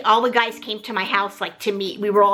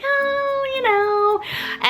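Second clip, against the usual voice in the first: wishy-washy; much slower than usual. Retold or imitated. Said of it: "wishy-washy".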